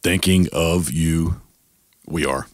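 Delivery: slow, low, drawn-out signature line; his put-on voice. Shown as a demonstration. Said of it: "Sometimes I put the voice on".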